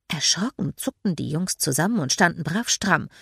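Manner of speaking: usual speaking voice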